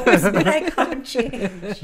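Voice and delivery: slow, laughing words. Real, not acted. Laughing at own joke.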